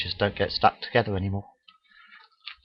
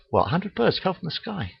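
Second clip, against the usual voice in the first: mocking gullible viewer; 9 semitones higher, 4 dB quieter than usual. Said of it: "You think".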